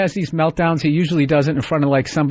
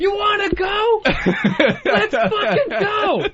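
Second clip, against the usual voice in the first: much higher slow macho shout; laughing mock brawler shout; action movie tough guy; 13 semitones higher, slower than usual. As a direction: ham up the action-hero challenge. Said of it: "like he's in an action movie".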